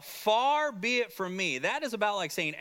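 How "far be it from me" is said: slow, higher, firm denial; emphatic denial. Retold or imitated. Teacher quotes Ahimelech's answer to the charge, emphatic denial. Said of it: "this emphatic de-, denial".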